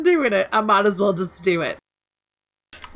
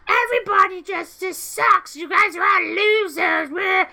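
high, drawn-out mock whine; bitching; 8 semitones higher than usual. Imitating a complaining player in battleground chat, mock whining. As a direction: whine it in a raised mocking voice. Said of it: "just sitting there bitching".

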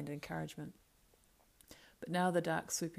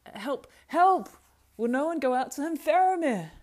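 desperate cry for help; 10 semitones higher, 13 dB louder than usual. Acted. Beregond seeing Faramir attacked, shouting desperately for help. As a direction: stammer breaking into high, loud shouts.

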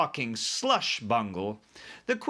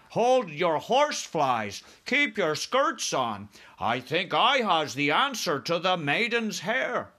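slow, higher giant voice; BFG, eager; 7 semitones higher, 4 dB louder, slower than usual. Acted. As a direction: interject as an eager friendly giant.

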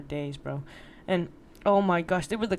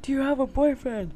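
higher-pitched mock call across the room; retold scream; 6 semitones higher, 3 dB louder than usual. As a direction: call over to a coworker, half-strength. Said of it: "I screamed over to her".

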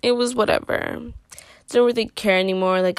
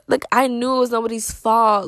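usual speaking voice

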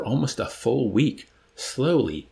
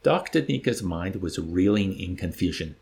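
ordinary delivery

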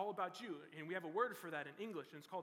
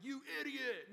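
higher, louder mock insult; angry yell; 6 semitones higher, 5 dB louder than usual. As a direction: snap it like an angry person. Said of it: "screaming like he's an angry person".

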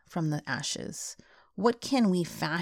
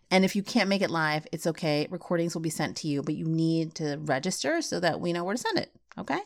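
usual speaking voice

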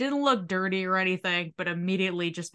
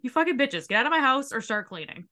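mock outburst with a laugh in it; mock furious yell; 6 semitones higher than usual. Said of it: "And it's like".

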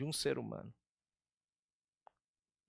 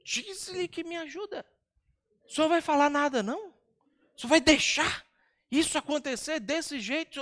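higher, fast whining complaint; exasperated Marta, voice pitched up and ranting; insolent Marta; 7 semitones higher than usual. Retold or imitated. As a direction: nag the Lord like a stressed hostess. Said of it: "uma pergunta insolente e depois dá uma ordem".

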